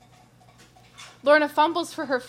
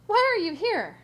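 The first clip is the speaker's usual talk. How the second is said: sudden higher, louder nervous demand; annoyed and afraid; 8 semitones higher, 4 dB louder than usual. Acted. Lorna confronting Doctor Ted, annoyed and scared. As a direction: snap the question out in fright. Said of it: "caught between annoyance and fear".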